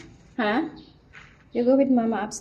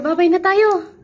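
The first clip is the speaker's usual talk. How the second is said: loud, higher sing-song farewell; loud goodbye; 6 semitones higher, 11 dB louder than usual. Real, not acted. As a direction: wave everyone off, raised and bright.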